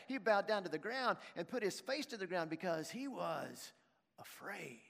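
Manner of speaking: normal talking voice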